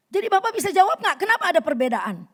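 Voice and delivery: loud, fast, high demand. Retold or imitated. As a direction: press him hard for an answer. Angry woman demands answer. (desakan cepat dan keras)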